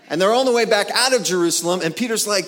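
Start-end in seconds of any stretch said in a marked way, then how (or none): none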